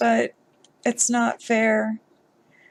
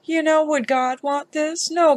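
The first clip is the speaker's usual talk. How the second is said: ordinary talk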